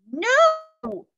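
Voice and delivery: very high, loud cry; shouted. Acted. Annie protesting the knight's threat, shouting. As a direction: shriek the word out in protest. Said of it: "shouted Annie".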